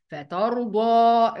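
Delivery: "فترضى" slowly chanted with thick d and back a; heavy daad. Shown as a demonstration. Teacher modelling for students, "فترضى" slow with emphatic daad. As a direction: thicken both ض and final alif of "فترضى". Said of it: "الضاد مفخمة".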